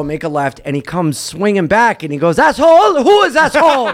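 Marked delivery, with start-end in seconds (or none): 2.4-3.9 indignant Frenchman, high and loud; loud, offended French-accented retort; mock French accent. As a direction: imitate an affronted French cyclist.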